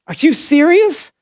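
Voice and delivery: indignant, pitched high; outraged disbelief. Real, not acted. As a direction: ask it in real outrage. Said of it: "I'm really aggravated".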